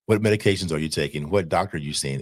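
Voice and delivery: ordinary delivery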